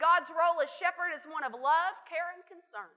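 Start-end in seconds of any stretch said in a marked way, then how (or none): none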